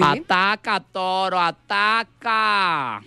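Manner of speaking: high drawn-out ataca; camp taunt. Acted. (provocación amanerada)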